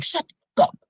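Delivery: sudden high, cold snap; behind-closed-doors snap. Retold or imitated. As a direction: turn nasty on a partner in private. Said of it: "behind closed doors".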